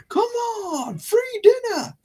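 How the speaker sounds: very high, sing-out coaxing; mock luring call. Presenter offering himself to animals, silly high luring call.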